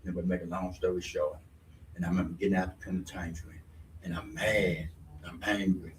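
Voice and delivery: low, soft and heavy; sad, subdued. Real, not acted. Sharing his mother's death, low and subdued. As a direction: drop low and quiet with grief.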